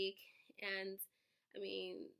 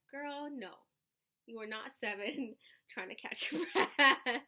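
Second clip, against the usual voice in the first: self-talk quote; 3 semitones higher, 9 dB louder, slower than usual. Retold or imitated. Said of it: "I was like".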